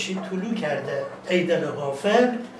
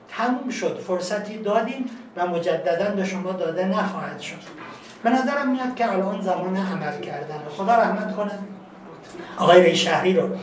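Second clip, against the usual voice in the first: normal talking voice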